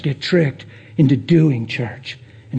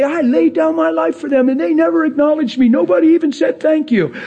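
mock whine; 11 semitones higher than usual. Preacher mimics hurt servant.

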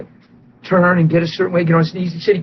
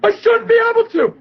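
excited shout. Retold or imitated. Healed man answering the preacher, a high excited shout. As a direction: blurt it out in wonder.

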